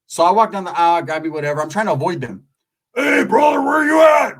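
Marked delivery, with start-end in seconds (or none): 2.9-4.4 loud, higher shout across the store; calling out. Retold or imitated. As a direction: yell a greeting across the aisle.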